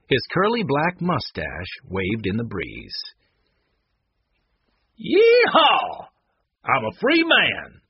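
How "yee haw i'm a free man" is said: high, loud cowboy whoop; whooping holler, falling at the end; exultant yell. A narrator's reading. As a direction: yell it out like a freed outlaw. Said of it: "he yelled".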